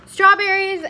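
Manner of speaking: "Strawberries" said high and loud, like a proud reveal; excited reveal. Real, not acted. Showing her strawberries to viewers, loud and excited. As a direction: announce "Strawberries" like a proud find.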